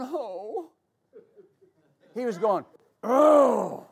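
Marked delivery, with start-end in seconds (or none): 0.0-0.7 soft, gentle "oh"; a quiet "oh", without any force; mild sigh of sorrow. Shown as a demonstration. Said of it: "Jesus wasn't going". 3.0-3.9 loud guttural groan; guttural groan of disgust. Shown as a demonstration. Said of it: "a guttural form of disgust".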